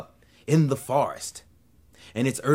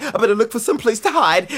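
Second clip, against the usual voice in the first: panicked snake; 9 semitones higher, 5 dB louder than usual. Acted. Snake repeats more panicked.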